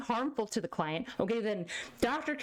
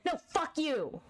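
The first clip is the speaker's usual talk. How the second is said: higher-pitched mock tantrum; rebellious child; 5 semitones higher than usual. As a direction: play a bratty kid defying the parents. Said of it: "Baby U S A said".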